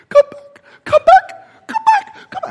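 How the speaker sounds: high, loud, strained call; high-pitched calling after rescuers; straining cry for help. Acted. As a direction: push a weak voice up into a high yelp. Acting survivor's calls growing stronger.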